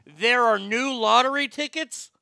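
slow, loud, high-pitched imitated disbelief; emphatic astonishment. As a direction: hammer each word in amazed question. Punchline repeated with full emphasis.

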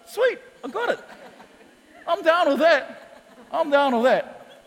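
put-on young convert thrilled by the simple answer; excited, laughing. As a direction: laugh as it finally clicks. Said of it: "I was like".

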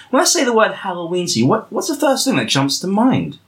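ordinary talk

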